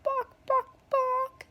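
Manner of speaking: high-pitched mock chicken clucks; bird clucking. Classmate joking all class long, high bird clucks. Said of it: "he sounded like a bird".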